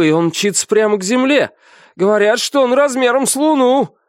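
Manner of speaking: high-pitched, urgent report; excited chattering. A narrator's reading. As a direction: rattle off the news in a high, excited voice. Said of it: "продолжал трещать первый помощник".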